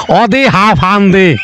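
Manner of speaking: slow, deliberate Barisal Bengali; অদে হাফ হান্দে drawn out in Barisal speech; Barisal dialect. Shown as a demonstration. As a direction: savour the dialect line for the audience. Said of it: "স্বচ্ছ বরিশালের ভাষায়".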